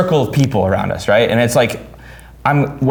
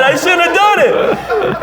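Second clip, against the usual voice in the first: high, very slow whine breaking into laughter; laughing whine; much slower than usual. Retold or imitated. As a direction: stretch it out while cracking up. Repeating through laughter.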